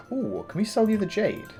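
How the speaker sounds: ordinary talk, nothing marked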